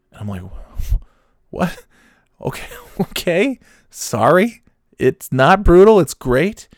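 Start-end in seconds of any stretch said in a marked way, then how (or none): 1.5-6.7 high, rising, questioning voice; acted thrown-off reaction, pitched way up; bewildered backpedalling. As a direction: retreat in confused mock apology. Acted bewildered backpedalling after being contradicted.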